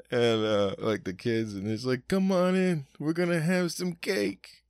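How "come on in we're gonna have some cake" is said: high, slow put-on host voice; welcoming parent. Mimicking parent welcoming guests in, high slow voice. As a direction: sing it out like a proud Sicilian host.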